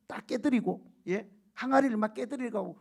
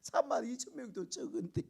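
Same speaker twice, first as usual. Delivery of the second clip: upset grumble; 5 semitones higher, 4 dB quieter than usual. Retold or imitated. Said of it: "속이 상했을 거예요".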